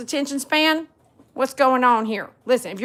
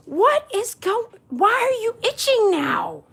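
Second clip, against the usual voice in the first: high, drawn-out exasperated complaint; 8 semitones higher, slower than usual. Real, not acted. Owner to the dog scratching itself, exasperated. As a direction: whine it slowly at a pitch well up.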